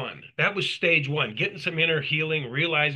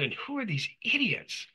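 pitched up high, scoffing disbelief; incredulous outrage; 6 semitones higher than usual. Retold or imitated. Man recalling the group therapy scene, indignant at the elders, scoffing. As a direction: scoff at them, higher and higher. Outraged thought at religious hierarchy.

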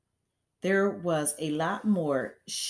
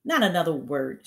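louder commanding shout; 8 dB louder, faster than usual. A narrator's reading. Headmistress shouting to silence the teacher. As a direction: cut her off loudly. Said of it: "shouted Miss Trunchbull".

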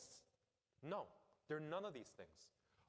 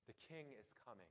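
the herald's cry now soft and far quieter; fading herald call; 10 dB quieter than usual. Retold or imitated. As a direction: let the announcement fade almost to a murmur. Herald's call dies away.

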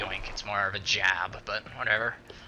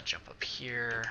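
whisper; 7 dB quieter, slower than usual. Real, not acted. Hiding from guards.

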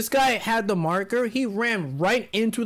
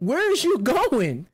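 higher, stretched-out incredulous question; mock bewildered call; 5 semitones higher, slower than usual. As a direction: call after the runaway player, slowly. Retold party calling after runaway.

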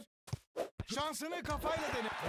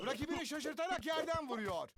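ordinary talk

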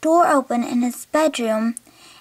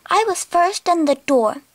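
usual speaking voice

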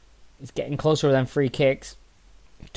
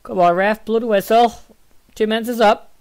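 high-pitched impatient appeal; raised, pressing protest; urging the referee; 8 semitones higher, 3 dB louder than usual. Real, not acted. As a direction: call out to the ref to end it. Urging referee to end the half.

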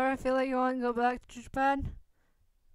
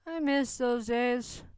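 ordinary delivery, nothing marked

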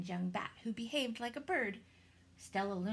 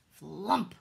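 loud landing thump; flump sound; 9 dB louder than usual. A narrator's reading. Bat lands in nest.

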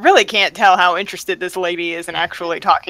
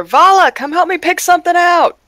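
high-pitched shout over the shoulder; calling out; 11 semitones higher, 4 dB louder than usual. Acted. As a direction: holler for a friend to come over. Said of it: "shout over my shoulder".

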